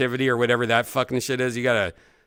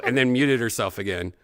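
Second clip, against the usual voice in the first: ordinary talk, nothing marked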